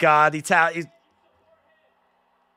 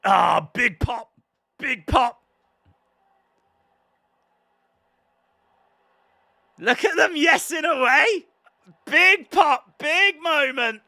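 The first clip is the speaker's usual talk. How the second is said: much higher, slower, buzzing with the crowd; excited; 9 semitones higher than usual. Real, not acted. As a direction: savour the big pop. Crowd reaction to the hit.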